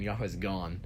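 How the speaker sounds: ordinary talk, nothing marked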